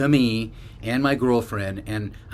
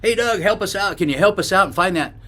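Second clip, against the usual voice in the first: higher-pitched call for help, only mildly raised; retold calling out; 7 semitones higher than usual. Pretending to summon a buddy for help, retold call. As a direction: call over to someone out of sight. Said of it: "real loud, even though he wasn't around".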